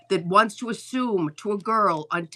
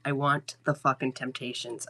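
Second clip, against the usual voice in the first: usual speaking voice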